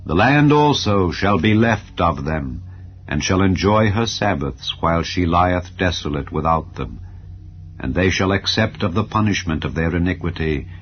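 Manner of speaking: plain reading voice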